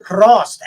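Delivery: Prost said out loud as a toast. Shown as a demonstration. Friend recalling the ski-resort toast, saying it aloud. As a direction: raise a glass and say Prost. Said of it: "you say it lightly and then slowly get louder".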